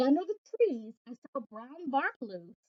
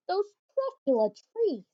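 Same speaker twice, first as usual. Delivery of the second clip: ordinary delivery, nothing marked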